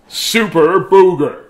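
loud slow superhero title; big announcer voice. A narrator's reading. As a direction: announce it like a hero.

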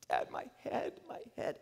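put-on whispered, hurting child's plea; pained whisper. Son waking his father in the tent, pained whisper. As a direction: breathe it out like a boy in pain.

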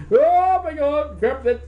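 high, loud panicked yelp, acted out; hammed-up frantic cry, stretched at the start; mock panic. Costumed friends scrambling as police clear them out, mock panic.

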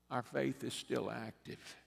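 ordinary delivery

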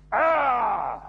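short loud mock cry of rage; a raging uncle's outburst; hammed-up yell; angry cry. Abu Lahab's angry outburst.

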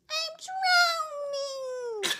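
high, drawn-out wail; crying out. A narrator's reading. Froggy cries to his mother in the pond, drawn-out high wail. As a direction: wail it slowly like a drowning child, hammed up. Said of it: "cried Froggy".

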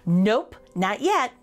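usual speaking voice

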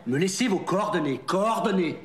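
loud, high prompting; emphatic correction. Shown as a demonstration. Boss feeding the trainee the forgotten line, loud emphatic correction.

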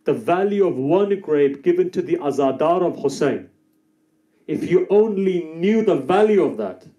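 a preacher rousing the crowd at the peak; fiery preaching. Acted. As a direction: preach it to the whole hall with full force. Preaching at full force again.

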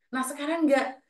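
ordinary talk, nothing marked

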